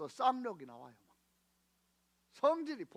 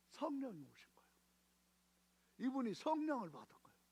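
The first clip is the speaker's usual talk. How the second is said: soft, awed whisper; whispered awe as the Spirit comes; hushed whisper; 3 semitones higher, 9 dB quieter, faster than usual. Acted. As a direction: drop to a reverent whisper.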